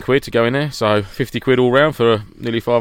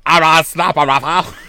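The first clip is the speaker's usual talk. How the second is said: drawn-out, higher silly voice; mocking pronunciation; 5 semitones higher, 4 dB louder, much slower than usual. Friend teasing the seller over the rifle's name, mocking and drawn out. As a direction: stretch every word into a hammed-up mock call.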